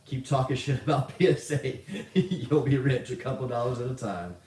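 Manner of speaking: chuckling mid-sentence; laughing. Real, not acted. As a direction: read it out through real laughter. Laughing at viewer's paid message.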